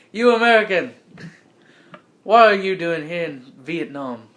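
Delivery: badly attempted French accent. Frenchman questioning the American across the table, failed French accent. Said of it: "there's a French man".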